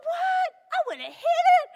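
high, loud, indignant disbelief; shocked, protesting squeal; incredulous. Retold or imitated. Pastor tells hunter she'd have hit the bear, indignant. Retelling own shocked reply.

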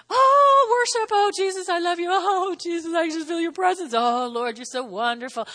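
hammed-up gushing worshipper, pitched high; mock churchy worship. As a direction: parody an over-the-top Sunday praiser. Mocking Sunday worship enthusiasm.

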